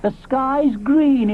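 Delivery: very slow, wondering; dreamy Ally. Acted. Ally gazes at Flower's leaves, very slow dreamy voice. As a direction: drift it out dreamily.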